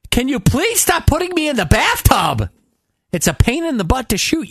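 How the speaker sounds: high, pleading complaint; whiny plea. Retold or imitated. Actress pleading with the director to stop the bathtub scenes, high and whiny.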